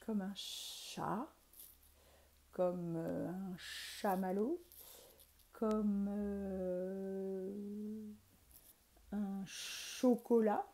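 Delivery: slow, drawn-out "chat", "chamallow", "chocolat"; slow teaching model words; stretched ch words. Shown as a demonstration. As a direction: linger on each "ch". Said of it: "C'est ce son-là".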